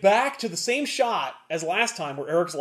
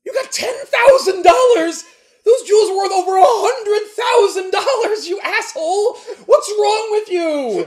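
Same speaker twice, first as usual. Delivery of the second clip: much higher, louder enraged yelling; furious shout; 9 dB louder than usual. Retold or imitated. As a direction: scream at him in outrage.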